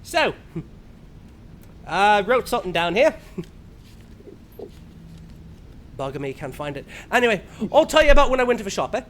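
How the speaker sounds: quick, casual Australian rambling; lightly voiced Australian storyteller; Aussie comedian ramble. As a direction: chatter on like a bloke at the pub. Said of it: "he's like".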